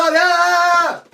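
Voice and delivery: high, loud mock scream; loud retold shriek; re-enacted scream. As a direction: let out a hammed-up yell of pain. Re-enacts screaming while crawling out.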